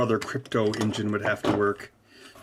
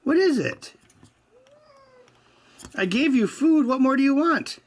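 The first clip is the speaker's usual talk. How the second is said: pitched-up chiding of the pet; 1 high cat-talk; 13 semitones higher than usual. Real, not acted.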